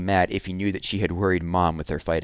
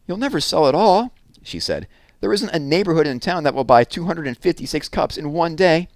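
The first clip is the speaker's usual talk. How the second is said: exasperated; 9 semitones higher, 5 dB louder than usual. Acted. Jessie scolding Evan.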